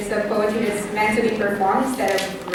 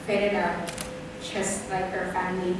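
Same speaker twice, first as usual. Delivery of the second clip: fading away; 2 semitones lower, 6 dB quieter than usual. Acted. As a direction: let each phrase die away softly. Laughter and family fading in poem.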